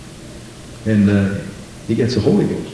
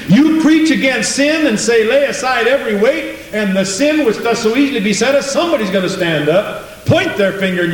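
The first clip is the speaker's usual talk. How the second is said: fervent preaching; 9 semitones higher than usual. Acted. A preacher crying out against sin to his congregation. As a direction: lift it up like a preacher pressing the point. Preacher rising to full force.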